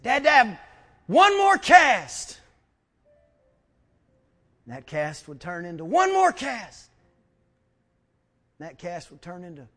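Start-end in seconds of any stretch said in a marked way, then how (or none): 0.0-2.4 high, loud drawn-out begging; hammed-up child's plea, stretched and high; pleading child. Acts child begging Dad. 5.8-6.9 raised, high repeated begging; acted loud plea, pitched up; insistent plea. First of repeated pleas, louder.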